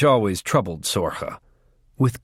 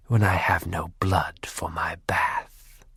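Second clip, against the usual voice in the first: low, voiceless whisper close to her ear; menacing whisper; 5 semitones lower, 5 dB quieter than usual. Acted. Bonanak crouches close, menacing.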